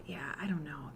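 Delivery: whispered aside; whispering. Real, not acted. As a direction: drop to a whisper. Offhand aside.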